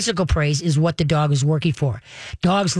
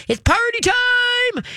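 squeaky high, slow party voice; mock party excitement; much slower than usual. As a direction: sing it out like a giddy dog owner. Mock excited dog mode.

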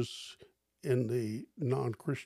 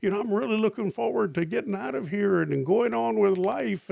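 mock cheerful; 7 semitones higher, 4 dB louder than usual. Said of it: "And you know what they say?".

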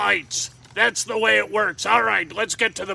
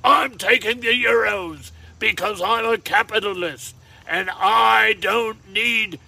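hammed-up English accent; playful Governor-style English accent; mock British accent; 2 semitones higher than usual. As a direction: put on a theatrical British voice. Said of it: "An English accent, if you will".

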